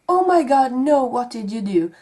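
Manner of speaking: "Oh my God" jumps high and loud, then settles; mock horrified gasp. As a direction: imitate a horrified aunt. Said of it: "for saying".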